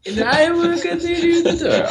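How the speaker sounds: very high, slow mock squeal; high-pitched imitation. Reenacting the scene about telegrams, talking up high. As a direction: stay way up high on one held note. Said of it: "Talking up high like this".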